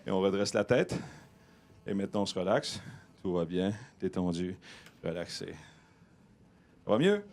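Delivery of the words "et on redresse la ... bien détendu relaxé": low, soft soothing hypnotist voice; hypnotic calm. Acted. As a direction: lull them down gently.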